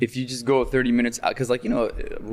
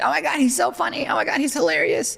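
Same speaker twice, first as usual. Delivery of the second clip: put-on gushing girlfriend; 13 semitones higher than usual. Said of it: "And she said".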